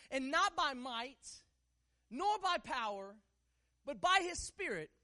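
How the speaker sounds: slow, weighted phrases with pauses; emphatic declaration. Real, not acted. Preacher quoting scripture to church, slow and emphatic. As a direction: declaim it phrase by phrase, lifting on the last.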